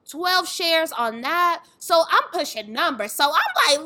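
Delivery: ordinary talk, nothing marked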